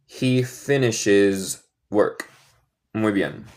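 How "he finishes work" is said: slow model sentence. Shown as a demonstration. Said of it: "teacher repeats model".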